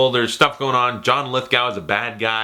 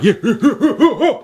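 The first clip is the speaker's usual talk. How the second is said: high, loud mock fight whoops; pulsing yell as if throwing punches; fight noises; 11 semitones higher, 5 dB louder than usual. Pantomiming the fight as the movie ended, whooping. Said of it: "pantomiming the fight".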